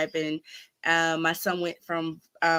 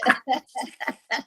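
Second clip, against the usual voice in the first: laughing; 7 semitones higher than usual. Real, not acted. Laughs at own joke.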